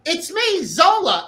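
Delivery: high-pitched, put-on announcement of identity; imitated reveal. Man reveals himself to a puzzled acquaintance, imitated reveal. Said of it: "And he says".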